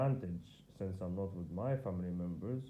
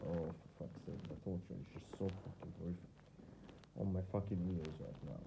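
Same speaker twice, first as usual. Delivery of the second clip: soft, quick muttered cursing; frustrated muttering; 2 semitones lower, 8 dB quieter, faster than usual. Real, not acted. Man cursing at the torture in his ears, muttering softly. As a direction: grumble it fast under your breath.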